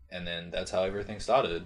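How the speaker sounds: ordinary delivery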